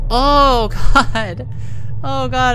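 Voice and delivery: loud nervous laugh, high and loud; real frightened laughing; scared laughter. Real, not acted. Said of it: "I'm laughing 'cause I'm scared".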